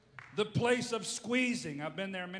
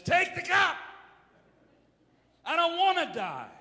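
shouted high and loud; raw outcry; 9 semitones higher, 8 dB louder than usual. Retold or imitated. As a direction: cry out the plea at full force. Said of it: "Now Mark says".

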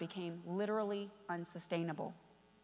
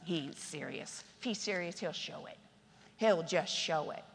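mock dismissive church member; 7 semitones lower, 8 dB quieter than usual. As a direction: mutter it dismissively.

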